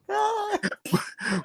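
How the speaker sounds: high, soft burst of laughter; cracking up at the rant. Real, not acted. Friend laughs at the argument.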